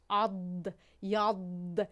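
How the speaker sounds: عد said low, isolated sound; pronouncing the letter. Shown as a demonstration. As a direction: model the letter as a teacher. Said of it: "این حرف داد هست". (نمایش تلفظ حرف ضاد)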